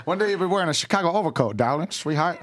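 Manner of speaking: put-on fast, loud, laughing 1920s mobster accent; 1920s Chicago accent. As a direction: rattle it off like a Chicago gangster. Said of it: "nineteen twenties accents".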